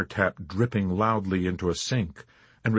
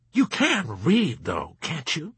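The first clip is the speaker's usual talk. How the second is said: higher, slow sneering jab; sarcastic; 7 semitones higher, slower than usual. Acted. Colonel mocking the chaplain, sarcastic. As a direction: drawl it out with scorn. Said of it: "the colonel persevered sarcastically".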